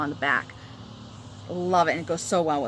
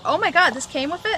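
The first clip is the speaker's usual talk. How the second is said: surprised; 9 semitones higher, 4 dB louder than usual. Retold or imitated. Retold finding the gift.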